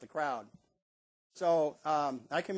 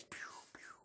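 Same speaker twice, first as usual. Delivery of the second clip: whisper; 19 dB quieter than usual. Shown as a demonstration. Pickoff call demo.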